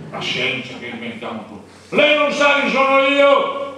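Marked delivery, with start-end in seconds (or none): 2.0-3.7 loud, high and slow indignant yell; arrogant shout. Retold or imitated. The officer on the bus, yelling slowly to pull rank on everyone. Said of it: "Io da con l'urlo".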